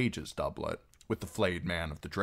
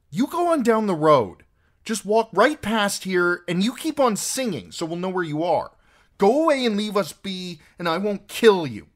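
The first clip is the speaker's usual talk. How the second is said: put-on high, loud child giving orders; defiant girl; 14 semitones higher, 11 dB louder than usual. A narrator's reading. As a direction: stand your ground as a fierce little girl. Arya threatens the men.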